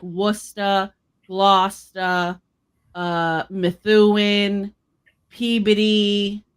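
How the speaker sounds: slow Boston-accented town names. Shown as a demonstration. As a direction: list the towns slowly in a Boston accent. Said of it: "put our accent on it".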